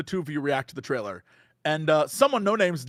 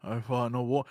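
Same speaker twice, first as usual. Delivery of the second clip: put-on grave old man; 7 semitones lower, 7 dB quieter than usual. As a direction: lower and softer, solemn. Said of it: "like, goes".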